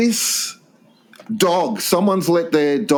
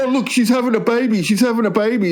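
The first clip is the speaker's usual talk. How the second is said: higher, excited retold exclamation; mock cooing announcement; 6 semitones higher, faster than usual. Man retells the couple's baby news, mock excitement. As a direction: imitate someone thrilled by baby news. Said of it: "And they go".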